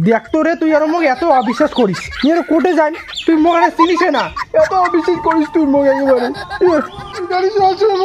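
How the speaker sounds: high-pitched, wounded mock crying; tearful protest. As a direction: plead as if crying at being distrusted. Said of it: "কান্দেন না".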